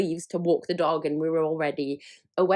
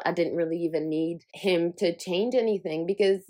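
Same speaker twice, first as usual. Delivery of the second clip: ordinary delivery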